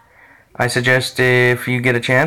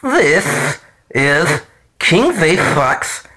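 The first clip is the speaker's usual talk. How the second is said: slow cartoon duck voice; Daffy Duck voice; 3 semitones higher, much slower than usual. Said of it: "Yes, I'm doing a Daffy Duck impression".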